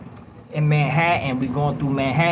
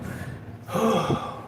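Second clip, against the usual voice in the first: big breathy exhale of relief; relieved sigh; 6 semitones higher, 6 dB quieter than usual. Retold or imitated. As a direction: let out a long airy breath. Acts gasp when doors open.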